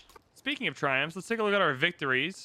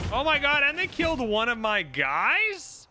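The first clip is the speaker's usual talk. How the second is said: much higher and louder, slowing at the end; outraged disbelief; 8 semitones higher, 4 dB louder than usual. Real, not acted. Streamer losing another unit, high outraged disbelief. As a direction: cry out at the unfair loss.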